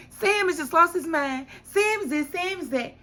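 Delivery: high-pitched mock complaint; Simone impression. Recapper voicing Simone dismissing Sam, high exaggerated griping. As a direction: rattle off grievances in a put-on high voice.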